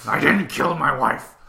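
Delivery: put-on action-star voice, slowly insisting; Harrison Ford impersonation. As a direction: do an earnest film star, loud and drawn out. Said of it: "that's my Harrison Ford impersonation".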